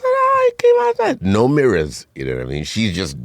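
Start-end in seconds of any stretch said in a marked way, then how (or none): 0.0-1.2 very high put-on mother voice. Mother admiring her work on his hair, very high voice. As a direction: squeak it out like a proud mom. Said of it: "she said".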